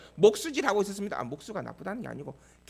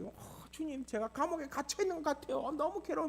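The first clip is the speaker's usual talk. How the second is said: higher, softer plaintive cry of David to God; pleading David; 9 semitones higher, 5 dB quieter than usual. Acted. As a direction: voice a hunted man groaning to his Lord. Preacher voices David's plaintive prayer. (높고 여린 목소리로 하소연하는 다윗)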